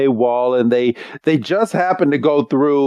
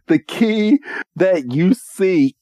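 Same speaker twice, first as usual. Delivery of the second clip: higher, slow, grand delivery; Wickstrom, portentous; 6 semitones higher, slower than usual. Retold or imitated. Trial master revealing the key, mock-portentous delivery. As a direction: space it out like a solemn quest-giver.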